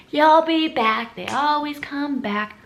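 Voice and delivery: high, slow singsong taunt; mocking customers. Customers hearing she was leaving, high mocking taunt. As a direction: draw it out in a put-on high voice. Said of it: "And all my customers".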